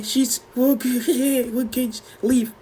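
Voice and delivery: high, slow, choked mock reassurance; struggling to speak. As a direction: squeeze the words out high, barely managing. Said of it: "but I can't speak".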